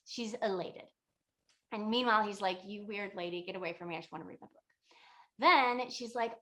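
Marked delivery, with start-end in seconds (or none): 2.7-4.6 annoyed muttering. Retold or imitated. Student wanting to read his book alone, muttered annoyance. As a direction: grumble it under your breath, imitating.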